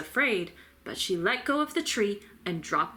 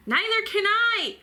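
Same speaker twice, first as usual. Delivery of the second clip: high, loud howl; 13 semitones higher, 5 dB louder than usual. A narrator's reading. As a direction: howl it out in panic. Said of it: "howled Flitter".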